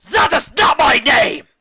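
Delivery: high, loud, slow outraged scream; furious shout. A narrator's reading. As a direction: scream it, stomping a hoof. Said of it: "Celestia shouted".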